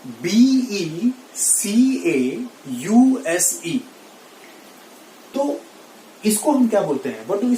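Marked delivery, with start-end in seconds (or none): 0.1-3.8 बी ई सी ए यू एस ई spelled letter by letter, slowly; slow spelling. Shown as a demonstration. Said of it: "क्या है?". (धीमे-धीमे spelling)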